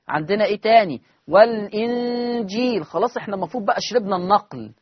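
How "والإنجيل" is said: والإنجيل slowly, lam with kasra; slow demo. Shown as a demonstration. Teacher pronounces the word for students to copy. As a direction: draw out والإنجيل carefully. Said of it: "هعمل النقل".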